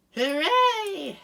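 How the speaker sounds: hammed-up celebratory shout; mock cheer. Real, not acted. Celebrating the sticker going on.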